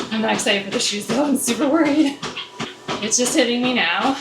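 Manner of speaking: normal talking voice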